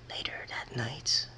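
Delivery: slow hushed whisper. A narrator's reading. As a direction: breathe it as a scene change. Narrator drops to a whisper.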